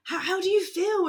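high, bright "How, how do you feel?" asked eagerly; chirpy live-stream reporter voice; eager interviewer. Retold or imitated. Girl live streaming asks Cheryl her feelings, eager high voice. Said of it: "And she was like".

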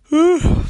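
big loud yawn; a long open-mouthed yawn. Real, not acted. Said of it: "disculpe usted si estoy bostezando". (bostezo largo y fuerte)

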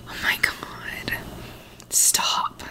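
hushed stunned whisper. Real, not acted. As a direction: breathe it out in disbelief. Shocked at price, hushed.

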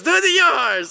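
high, loud mimicked command; insistent urging. Teller as camp kid insisting he try, insistent shout.

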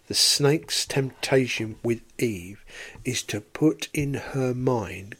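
ordinary talk

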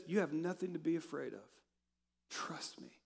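soft words dropping into a whisper; hushed reassurance. Acted. God reassuring his child, hushed. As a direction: reassure softly, ending on a bare whisper.

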